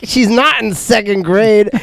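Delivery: a lady teasing the worried dad; mock scoffing. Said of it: "they're like".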